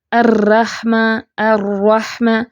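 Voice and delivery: الرحمہ with the ر rolled and repeated, slow; exaggerated trilled r in الرحمہ; over-trilled r. Shown as a demonstration. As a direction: roll the ر in الرحمہ too long, as a wrong example. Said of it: "تو اس طرح نہیں پڑھنا".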